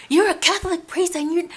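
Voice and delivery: high, sharp telling-off of a priest; mock scolding. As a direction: scold him in disbelief, high and pushed. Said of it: "I was kinda scolding him".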